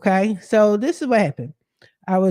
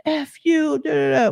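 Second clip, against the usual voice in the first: mock cursing; 8 semitones higher than usual. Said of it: "he started cursing".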